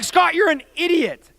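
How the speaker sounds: high, loud acted reproach; exasperated scolding. Mark scolding his buddy on the train, loud exasperated yell. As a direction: yell it at a foolish friend. Friend scolds him in quarrel.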